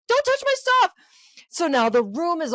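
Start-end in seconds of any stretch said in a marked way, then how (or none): 0.1-0.9 high, loud mock scolding; mock angry yell. Angry man guarding his things, mock yell. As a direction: snap like a possessive kid. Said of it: "He was like".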